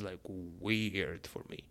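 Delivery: ordinary delivery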